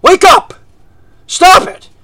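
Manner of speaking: imitating a preacher's high, loud yells; full-voiced mimicked pulpit shouts; yelling preacher. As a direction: bellow two commands like a fired-up preacher. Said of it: "and he started yelling".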